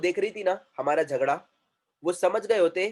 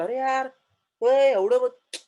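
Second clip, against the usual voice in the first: mock exasperation; 6 semitones higher, 5 dB louder, much slower than usual. Acted bystander frustrated at fight ending.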